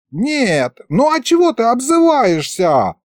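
offended dragon head wails at Fedya; wailing. Acted. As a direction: whine that he called it names. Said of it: "завопила левая голова".

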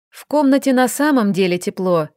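plain reading voice

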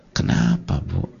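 soft, half-whispered kenapa; hushed concerned ustadz; gentle question. Retold or imitated. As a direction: ask the mother quietly and kindly. Ustadz answering worried mother.

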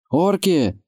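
high-pitched questioning call; anxious villager's question. A narrator's reading. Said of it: "спросил кто-то".

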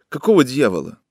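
ordinary delivery

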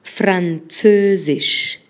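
Französisch repeated very slowly, every syllable held; slow teaching repeat of Französisch. Shown as a demonstration. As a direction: drag out Französisch again. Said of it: "vamos a agregar el francés".

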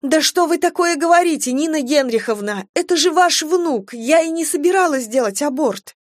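high, indignant pleading; distressed young wife pleading back; shocked protest. A narrator's reading.

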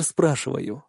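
plain reading voice

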